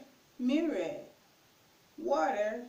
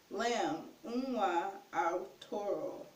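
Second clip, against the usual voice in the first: ordinary delivery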